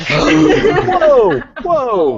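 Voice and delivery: high, loud laughing "whoa"; mock alarm. Co-host halting a Santa spoiler, mock alarm. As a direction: cry out in playful shock.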